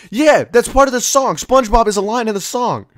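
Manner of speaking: high, loud mock-defensive comeback; indignant insistence. Singer defending the lyric to a friend, indignant.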